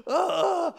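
sudden high yelp of mock terror; a hammed-up shriek of fear; mock scream. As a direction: let out a high yelp, half laughing. Mock scream at dad's order.

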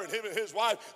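ordinary talk, nothing marked

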